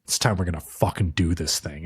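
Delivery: imitating him, low and serious; super serious. Retelling the boy getting her ready, super serious low voice. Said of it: "super serious and he's like".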